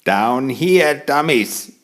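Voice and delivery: a touch of impatience in a small voice; impatient little voice. Acted. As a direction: call up at them, a little annoyed. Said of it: "cried the little voice impatiently".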